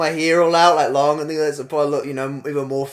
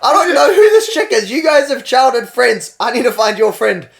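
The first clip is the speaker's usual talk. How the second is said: higher, louder frustrated rant; exasperated; 7 semitones higher, 6 dB louder than usual. Retold or imitated. Narrator scolding the friend about the lost mate, exasperated. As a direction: vent at her, exasperated. Frantic telling-off in story.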